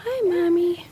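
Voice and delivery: squeaky little-dog voice; high puppy voice. Pretend. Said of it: "Can you say, "Hi, Mommy"?".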